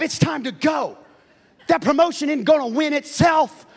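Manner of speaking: loud, high hype-coach shouting; kid coaching dad. Retold or imitated. Child pushing dad to hustle for promotion, loud coaching shout. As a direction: yell it like a coach pumping up a player. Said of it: "kids started talking to us like that".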